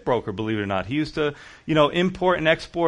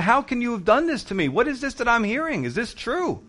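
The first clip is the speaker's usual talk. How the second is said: upset boss; 6 semitones higher than usual. Retold or imitated. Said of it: "He's upset".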